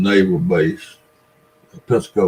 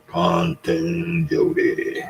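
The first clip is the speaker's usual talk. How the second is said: drawn-out name call; 9 semitones higher, 6 dB quieter, much slower than usual. Real, not acted. Reads a viewer's name aloud.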